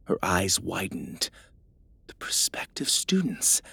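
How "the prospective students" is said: whispering. Acted. Stunned realization in the dark.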